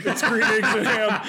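laughing mock cheer. Joking quote to Dr Seuss.

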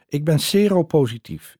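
put-on German accent. Boyfriend confessing his HIV status in bed, German accent. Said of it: "zei hij plotseling".

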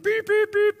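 high-pitched motorbike beeping; imitated horn beeps. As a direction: toot like a scooter horn. Imitates delivery bike horn.

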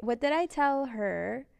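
ordinary delivery

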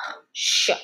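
hushed whisper. Acted. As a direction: whisper it under your breath. Late, hushed aside.

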